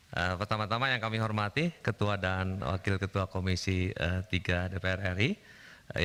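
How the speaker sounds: hoarse, recovering from illness; raspy voice after long covid. Real, not acted. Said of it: "mohon maaf suara agak serak ini". (suara serak)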